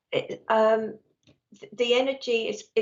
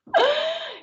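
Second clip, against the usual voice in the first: very high, squealed laugh; sudden high-pitched laugh; 4 dB louder than usual. Real, not acted. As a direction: burst into a short high laugh. Laughs after dog startled her.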